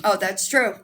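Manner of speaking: usual speaking voice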